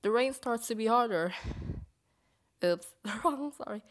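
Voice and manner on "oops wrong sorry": ordinary talk, nothing marked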